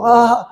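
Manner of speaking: high-pitched mock "aah" of panic; imitated crowd scream. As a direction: imitate frightened passengers without real volume. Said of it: "gritan todos juntos".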